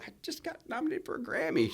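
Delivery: thrilled announcement. Retold or imitated. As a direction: blurt out the big news to the cashier. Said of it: "I said".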